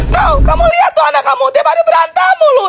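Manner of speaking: angry complaint. Acted. Wife complaining to husband loudly.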